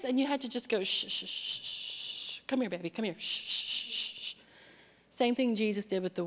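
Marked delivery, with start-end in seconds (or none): 0.8-4.3 put-on soft hushing, come here baby; gentle shushing to calm; soothing a child. Soothing upset wife like baby.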